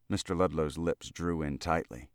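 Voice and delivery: plain reading voice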